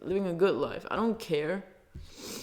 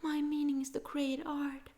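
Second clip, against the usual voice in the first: mock lofty voice; 8 semitones higher, 6 dB quieter than usual. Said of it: "I can't be like".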